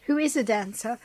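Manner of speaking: usual speaking voice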